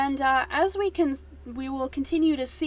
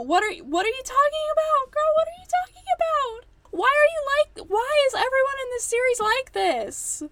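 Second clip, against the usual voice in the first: very high, loud disbelieving cry; exasperated shriek; 14 semitones higher, 7 dB louder than usual. Real, not acted. Yelling at the whole series, exasperated shriek. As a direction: scream it in total exasperation.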